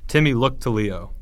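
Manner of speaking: plain reading voice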